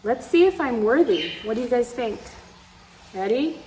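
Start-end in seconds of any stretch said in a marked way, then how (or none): none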